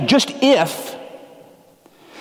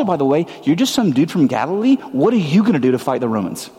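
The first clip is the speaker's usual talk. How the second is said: ordinary delivery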